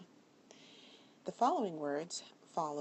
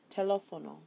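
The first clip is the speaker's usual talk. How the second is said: LÉ stressed in Teléfono; stress on second E; 2 semitones higher, 8 dB louder, faster than usual. Shown as a demonstration. Teacher explains the broken rule, Teléfono stressed on LÉ. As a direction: say Teléfono with the weight on its second syllable, LÉ. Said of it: "it's actually on the second E".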